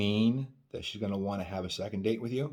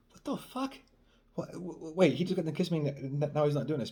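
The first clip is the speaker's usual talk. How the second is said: high-pitched, stammering disbelief; baffled; 8 semitones higher than usual. Acted. Woman puzzled that her date stopped kissing, mock-bewildered. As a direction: act bewildered, tripping over the words.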